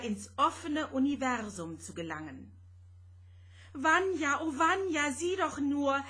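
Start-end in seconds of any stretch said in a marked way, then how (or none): 3.8-6.0 higher, louder call of distress; frightened cry pointing at the sea; desperate cry. A narrator's reading. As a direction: cry out for him in utter need. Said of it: "schrie Rosetta in höchster Not".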